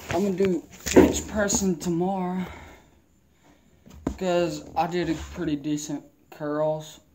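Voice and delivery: talking around a lip of dip; mumbled with tobacco in lip; lip full of dip. Real, not acted. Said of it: "I already got a dip in".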